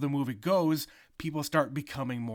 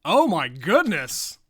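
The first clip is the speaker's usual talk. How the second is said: put-on wholesome 1950s kid; 3 semitones higher, 9 dB louder, slower than usual. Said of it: "What does that mean?".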